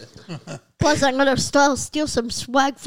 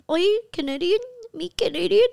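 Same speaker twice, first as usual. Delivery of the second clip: higher-pitched, badly faked Canadian; mock Canadians with a terrible accent; clumsy Canadian impression, lilting upward; 6 semitones higher than usual. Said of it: "Worst accent".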